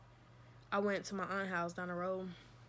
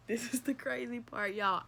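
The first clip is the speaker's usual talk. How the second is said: laughing; 5 semitones higher, 3 dB louder than usual. Real, not acted. Laughing repeat of the teaser.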